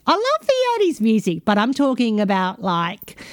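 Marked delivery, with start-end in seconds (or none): 0.1-1.4 gushing. Real, not acted. Gushing aside about eighties music.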